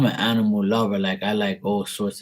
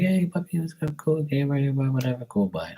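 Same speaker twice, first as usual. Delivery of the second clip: high, breezy hello to a passing dog; light mock puppy chatter that drifts down to casual; casual dog greeting; 7 semitones higher than usual. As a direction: chirp it brightly at the pup, then lose interest. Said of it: "be like".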